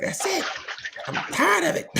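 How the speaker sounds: put-on high, young kid's outburst; a squeaky kid who has had enough; child voice. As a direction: play the fed-up ten-year-old. Ten-year-old voiced in story.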